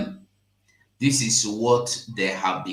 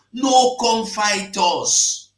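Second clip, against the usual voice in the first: 1 slow loud warning; 11 semitones higher, 6 dB louder, much slower than usual. Real, not acted. Warning shouted at outsiders.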